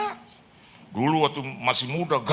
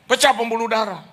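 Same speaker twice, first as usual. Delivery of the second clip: ordinary delivery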